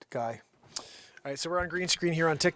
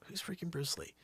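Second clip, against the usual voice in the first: usual speaking voice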